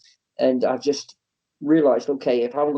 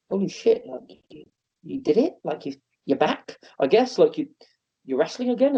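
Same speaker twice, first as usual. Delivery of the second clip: higher, amazed praise to himself; elated self-talk; 6 semitones higher than usual. Retold or imitated. Wrestler after his comeback match, amazed and high. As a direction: tell yourself you did it, amazed. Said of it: "saying to myself".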